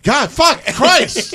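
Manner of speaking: high-pitched mock cursing; exasperated turtle outburst. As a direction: play a fed-up turtle swearing way up high. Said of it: "the turtle would be like".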